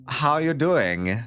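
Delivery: put-on slow, drawn-out Tommy Wiseau accent; playing Nomi as Tommy Wiseau, slow and drawn out. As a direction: lean into a Tommy Wiseau drawl. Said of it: "we'll try doing a Tommy Wiseau accent".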